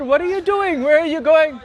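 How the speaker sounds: high, startled cry of a worried mother; alarmed mother. Acted. Yashoda alarmed at Krishna leaving.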